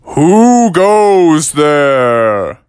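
booming, drawn-out guardian's challenge; scary voice. Acted. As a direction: call out loud and very slow to frighten an intruder. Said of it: "your voice is pretty scary".

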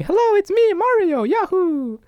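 very high, cartoonish game-hero voice; Mario. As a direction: squeal a slow, drawn-out catchphrase. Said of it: "went in the booth and was like".

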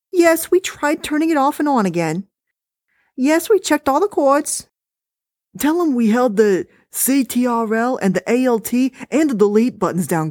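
dismissive, high and chatty; wife voice. Acted. Wife complaining to husband about the recording, high-pitched.

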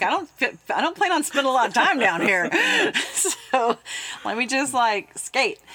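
high, loud, laughing protest. Retold or imitated. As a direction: laugh it off loudly. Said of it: "I'm like".

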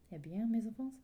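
put-on French accent. Priest finds the couple together, French accent. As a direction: greet them brightly in French accent. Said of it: "I really enjoyed your French accent".

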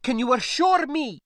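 put-on high, loud accented challenge; anxious niece. Niece asking spacer to promise survival, distressed. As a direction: demand reassurance, upset.

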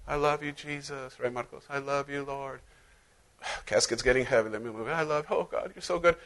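soft, hushed devotional murmur; dreamy worship. Pretend. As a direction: breathe it like lost in worship. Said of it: "making the fool out of myself".

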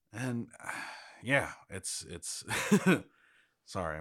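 real choked-up hesitation, sighing, breaking into a small laugh; a little choked up. Real, not acted. Telling a friend what they hope for, a little choked up. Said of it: "I'm getting a little choked up".

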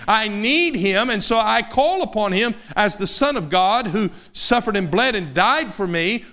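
normal talking voice

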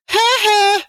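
put-on high, nasty little-kid taunt; mocking kid taunt. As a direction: jeer at the frozen kid. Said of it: "you go".

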